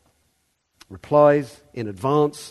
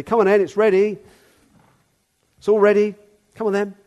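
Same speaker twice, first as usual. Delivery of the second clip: loud high summons; calling out; 7 semitones higher, 6 dB louder than usual. Retold or imitated. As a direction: call them over, full voice. Servant calling at the next house.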